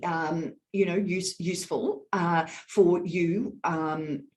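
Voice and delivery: halting, choppy, with gaps after "useful" and "you"; hesitant delivery, stalling on "um" and "you know"; broken air. Shown as a demonstration. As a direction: break the air with fillers and stops, as at "to, to". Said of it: "when I break my air like that".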